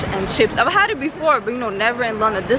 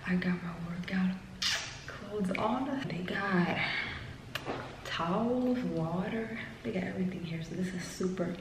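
hushed near-whisper, much softer; 11 dB quieter than usual. Real, not acted. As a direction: keep it hushed so nobody in the building wakes. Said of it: "I feel like I'm whispering".

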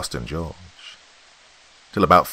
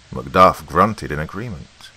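usual speaking voice

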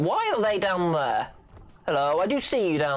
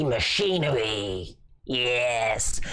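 slow, lower, announcer-style drawl on machinery; dramatic low announcer; 6 semitones lower, much slower than usual. Pretend. Gamer announcing the level title in a slow, low announcer voice. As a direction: intone it like a movie trailer.

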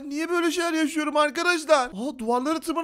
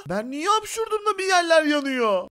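ordinary talk, nothing marked

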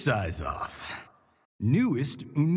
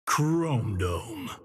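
ordinary talk

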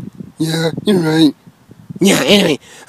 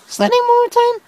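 high character voice. Acted.

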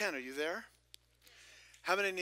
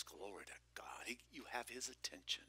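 much softer, hushed reverent whisper; 2 semitones lower, 11 dB quieter than usual. Real, not acted. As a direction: breathe it in awe. Preacher murmurs in awe.

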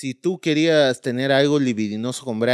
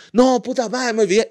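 fake panic; 9 semitones higher than usual. [name] feigning forgetfulness.

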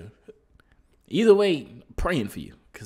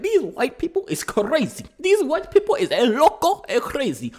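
a light Mexican accent, higher and louder; 10 semitones higher, 4 dB louder than usual. Real, not acted. Reacting to the daughter's outburst in a light Mexican accent. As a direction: slip into a playful Mexican accent. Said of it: "why am I in a Mexican accent?".

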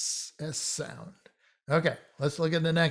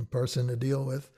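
usual speaking voice

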